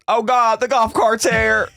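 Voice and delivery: high-pitched alarmed retelling; hammed-up "oh God" alarm; mock panic. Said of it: "And she goes".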